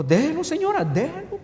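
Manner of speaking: pleading. Retold or imitated. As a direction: plead with the lady to let him be. (súplica con voz más aguda)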